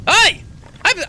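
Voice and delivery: put-on loud, high old man's hail; high-pitched old-timer voice, before any Scottish; old man character. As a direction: start the old hermit's reply at a high shout. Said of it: "oh, he's probably more Scottish".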